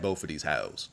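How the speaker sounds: low, quiet demonstration of a challenge; said just so. Shown as a demonstration. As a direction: drop low and soft to model the line. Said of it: "She's got to say it like that".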